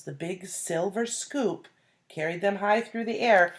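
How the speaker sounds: ordinary delivery, nothing marked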